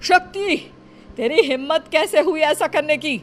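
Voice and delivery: angry scolding. Acted. Guardian catches them.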